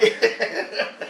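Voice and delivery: high giggling fit; real laugh. Real, not acted. Storyteller finding the emoji bit funny, laughing. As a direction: giggle helplessly at the punchline.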